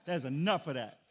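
a retold loud "enough" from an irritated dad; retold stern outburst. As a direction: snap at the kid like a fed-up father. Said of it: "I was like".

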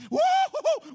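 put-on very high, loud kid's whoop of joy; excited whoop. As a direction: whoop like a six-year-old off to Disney. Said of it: "my adrenaline was pumping".

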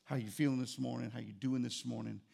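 low, soft loving good morning; softly sweet to his wife; tender morning greeting. Retold or imitated. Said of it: "I go".

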